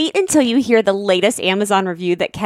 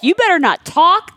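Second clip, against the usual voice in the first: sharp warning; 9 semitones higher, 4 dB louder than usual. Retold or imitated. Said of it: "I'm like".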